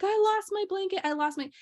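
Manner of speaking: retold sad child. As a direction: lightly mimic a child upset over a loss. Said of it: "it was making him sad".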